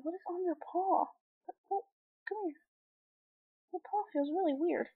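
high curious voice to a cat; pet talk. Real, not acted. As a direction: fuss over the pet's paw. Talking to the cat.

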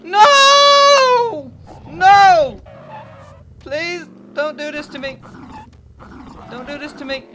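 high wailing don't do this to me; howled mock-tragic pleading; anguished crying plea. Real, not acted. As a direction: beg in despair. Losing in game.

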